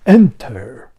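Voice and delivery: loud, grand imitated command; imitating a dramatic, booming summons; theatrical caped host. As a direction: announce it like a caped stage villain. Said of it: "he's got a cape on".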